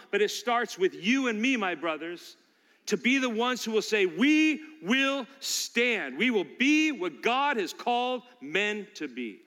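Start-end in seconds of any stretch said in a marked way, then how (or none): 4.1-9.4 very slow, louder and higher, each word drawn out; solemn pledge. Acted. Preacher leading the men in their vow to stand, slow and loud. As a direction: let it ring like an oath.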